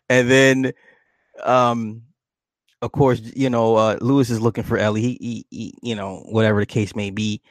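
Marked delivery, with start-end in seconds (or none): none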